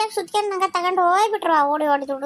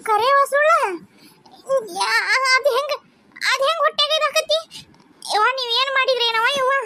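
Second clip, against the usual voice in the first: shocked outcry; 6 semitones higher than usual. Acted. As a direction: demand answers in disbelief. Mother confronting nurse in shock. (ಆಘಾತದಲ್ಲಿ ಎತ್ತರದ ದನಿಯಲ್ಲಿ ವೇಗವಾಗಿ ಪ್ರಶ್ನಿಸುವುದು)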